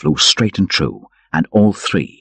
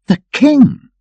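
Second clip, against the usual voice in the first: much higher, stunned exclamation; astonished cry; 5 dB louder than usual. Acted. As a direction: gasp it out in wonder. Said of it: "echoed Robin".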